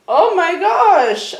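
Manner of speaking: giddy high-pitched stoner awe; stoned and blissed out. Acted. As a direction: marvel at everything like you're high. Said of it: "Zig was like".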